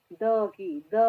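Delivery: soft dental "द" on its own; soft D sound. Shown as a demonstration. Teacher demonstrating the Hindi sound of D, soft dental "द". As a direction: say "द" with the tongue on the teeth. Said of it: "दी को हम द की आवाज दे रहे हैं".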